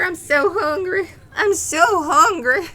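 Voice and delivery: whiny hungry plea. Acted. Player whining about food.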